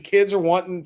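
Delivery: ordinary talk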